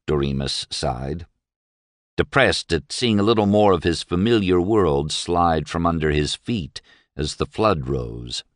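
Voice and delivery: usual speaking voice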